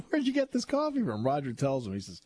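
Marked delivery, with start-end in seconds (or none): 0.1-1.2 mock indignant question. Joe's question retold.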